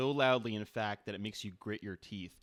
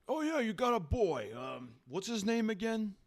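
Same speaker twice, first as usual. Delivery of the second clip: high, loud offhand boss; careless boss voice; 12 semitones higher, 6 dB louder than usual. Acted. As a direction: ask like you don't care. Boss voice asking casually.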